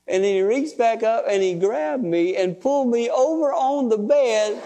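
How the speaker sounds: ordinary talk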